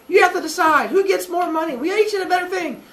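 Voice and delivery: high, whiny group complaint; squabbling groups. Retold or imitated. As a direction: pitch the villagers up into a whine. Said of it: "yelling and they're like".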